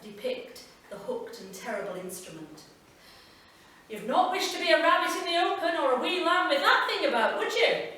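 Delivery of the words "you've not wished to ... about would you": high, loud and fast, a jokey Scots postman; Andrew, chatty postman. Acted. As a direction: rattle it off as the amused, slightly spooked postie. Postman Andrew talks about the beak.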